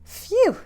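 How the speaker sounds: high, loud relieved phew; hammed-up phew of relief for the kids. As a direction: blow out a big storybook sigh of relief. Narrow escape from skateboard.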